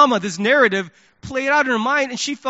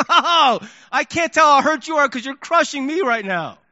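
wounded protest; 6 semitones higher, 6 dB louder than usual. Retold or imitated. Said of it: "I'm like".

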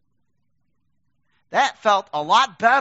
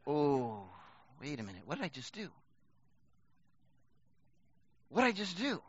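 quiet dismay; 7 semitones lower, 12 dB quieter than usual. Retold or imitated.

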